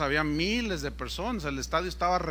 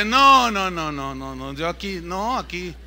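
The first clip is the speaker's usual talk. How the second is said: mock reluctant refusal; 5 dB louder than usual. Preacher dodging what God told him to say, mock refusal. Acts himself refusing God's prompting.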